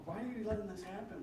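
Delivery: high-pitched incredulous protest; acted-out exasperation; mock complaint. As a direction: question God in disbelief, pitch leaping up. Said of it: "this is his complaint to God".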